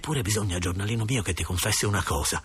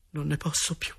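softer, higher sigh of exhaustion; weary despair; 4 semitones higher, 4 dB quieter than usual. Acted. Boy alone in his room, quietly despairing. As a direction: say it quietly, at the end of endurance.

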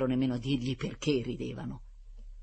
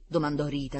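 ordinary delivery

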